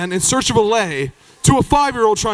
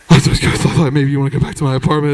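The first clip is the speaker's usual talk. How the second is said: low mumbling persistence; low talker; 7 semitones lower than usual. Retold or imitated. Jeff still pushing his apartment on the group, low mumble. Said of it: "Jeff was a low talker".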